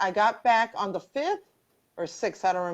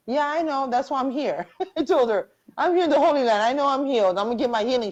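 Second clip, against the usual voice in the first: higher, louder retold comeback; animated retort; 7 semitones higher, 5 dB louder, faster than usual. Answering her friend's healing claim in the Holy Land, animated retort. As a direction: toss it back insistently, pitched up.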